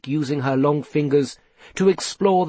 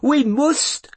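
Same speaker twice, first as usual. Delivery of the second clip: very high, anguished wail; 12 semitones higher, 3 dB louder than usual. A narrator's reading. Mother begs for a search, wailing. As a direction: wail it out in desperation. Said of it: "wailed Norrie".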